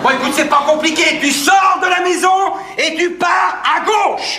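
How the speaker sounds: high, slow, emphatic directions; exasperated explaining. Acted. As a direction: spell it out as if to an idiot. Réexplique le chemin, à bout. (explication excédée, aiguë et martelée)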